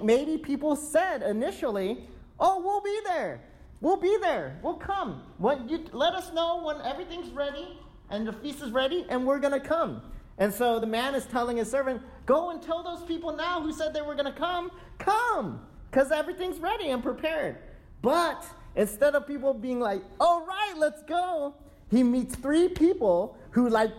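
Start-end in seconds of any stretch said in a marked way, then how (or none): 2.4-10.0 eager acceptance. Retold or imitated. Guests eagerly accepting invitation. 12.3-17.6 urgent host. Retold or imitated. Host sends servant to guests. 20.2-21.5 high, loud cheerful agreement; eager agreement. Retold or imitated. Imagined eager guests.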